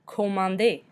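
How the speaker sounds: Commander as French koh-mahn-DAY; silent final R. Shown as a demonstration. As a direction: say Commander ending in an AY, no R. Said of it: "We do not pronounce the R".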